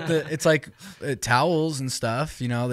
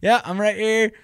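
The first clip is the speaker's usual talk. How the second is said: calling from the stall; 9 semitones higher, 4 dB louder than usual. Retold or imitated. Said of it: "I'm like".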